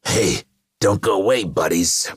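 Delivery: loud, high call to the crowd; Carnage crying out. A narrator's reading. Carnage to killers shrinking away, loud jovial cry. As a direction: call them back with rowdy bravado. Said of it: "Carnage cried".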